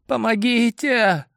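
high, thin cry for help; faint plea from a cell; faint girl's cry. A narrator's reading. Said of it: "раздался едва слышимый голос".

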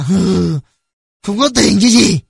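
cold, accusing father; cold contempt. A narrator's reading. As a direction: sneer it at the boys as an accusation. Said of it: "lạnh lùng hỏi".

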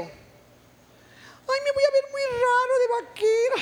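very high, squealed mincing protest; effeminate little voice. Retold or imitated. Preacher imitating the man balking at cowboy clothes, high little effeminate voice. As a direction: imitate him fretting in a tiny high voice. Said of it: "con una vocecita".

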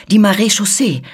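high, loud shouts, climbing to a shriek; screaming for help. A narrator's reading. Servant calls for rescue from the intruder, screaming. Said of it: "schrie die Martiniere".

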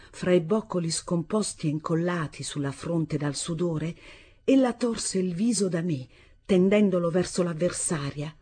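plain reading voice

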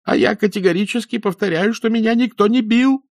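high, loud insistent shout. Acted. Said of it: "снова закричал Гилевич". (отчаянный крик)

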